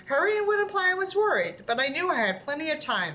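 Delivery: steady storytelling read; story narration. Acted. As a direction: read the tale to the audience. Reads a horror story aloud.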